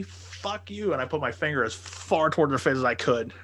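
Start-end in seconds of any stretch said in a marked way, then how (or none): 0.0-0.9 high-pitched retort without shouting; retold angry retort.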